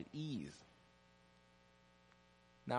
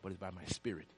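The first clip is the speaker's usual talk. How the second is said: softer and lower, fading reverently; solemn scripture quote; 6 semitones lower, 5 dB quieter than usual. Acted. Preacher quoting scripture to the congregation, solemn and fading. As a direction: let the verse settle quietly.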